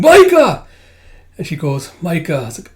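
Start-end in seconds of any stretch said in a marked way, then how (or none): 0.0-0.7 very high, piercingly loud cry; waking scream. Acted. Tuya waking from the nightmare, screaming. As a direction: scream the name out.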